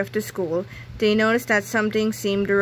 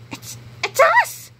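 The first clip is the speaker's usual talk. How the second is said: shocked gasp; 3 dB louder than usual. A narrator's reading. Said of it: "whispered George".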